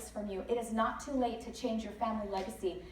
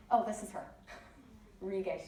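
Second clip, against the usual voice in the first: ordinary talk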